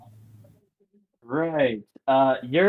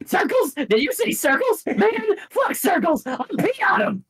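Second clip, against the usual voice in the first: very high, indignant cartoon bird; mock outrage, squeaky and high; high-pitched Skarmory voice; 11 semitones higher than usual. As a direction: yelp it in a silly high voice. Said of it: "doing a random high-pitched voice".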